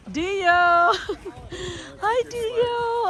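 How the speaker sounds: high squeal of hello to the dog; excited dog greeting. Real, not acted.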